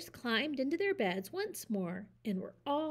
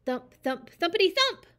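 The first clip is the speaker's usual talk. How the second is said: building to a high, loud thump; 8 semitones higher, 9 dB louder than usual. A narrator's reading. Narrator builds up the thumping noise. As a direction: let the thumps grow.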